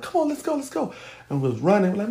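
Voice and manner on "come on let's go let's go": high-pitched, breakneck reenactment of fleeing; retold urgent urging. Mother fleeing with child, retold urgent urging. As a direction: hurry them along in a raised pitch.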